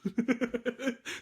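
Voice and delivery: high, breathy evil cackle; maniacal laugh. Pretend. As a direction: mad scientist cackle, played lightly. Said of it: "That was my maniacal laugh".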